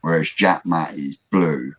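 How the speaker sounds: ordinary delivery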